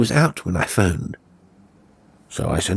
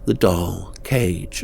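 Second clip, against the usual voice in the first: ordinary delivery, nothing marked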